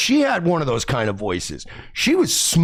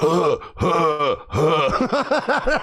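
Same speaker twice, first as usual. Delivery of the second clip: breathy imitated moans, breaking into a laugh; mock moaning; much slower than usual.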